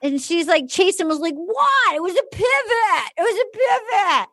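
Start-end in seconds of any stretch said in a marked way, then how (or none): 1.3-4.3 high, indignant imitation; pitched-up, whiny self-defence; mock defensive protest. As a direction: protest as if wrongly accused. Acting out Jason defending himself when confronted.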